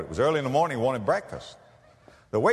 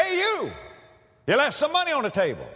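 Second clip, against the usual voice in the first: high, sharp call after him; faster than usual. Retold or imitated. Waitress calls after the departing preacher, high and urgent. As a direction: call him back across the room.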